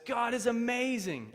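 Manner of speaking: high, loud, slow proclamation; triumphant exclamation. Retold or imitated. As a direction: announce it in joyful amazement. Friend's triumphant message read out.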